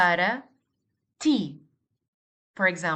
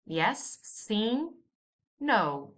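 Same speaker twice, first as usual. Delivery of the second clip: ordinary talk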